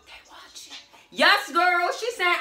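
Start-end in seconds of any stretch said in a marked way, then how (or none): 0.1-0.7 secretive whisper. Real, not acted. Warning the viewer that they are watching, whispered.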